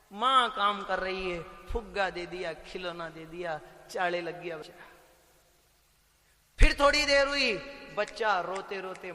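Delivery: normal talking voice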